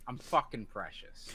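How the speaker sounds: ordinary talk, nothing marked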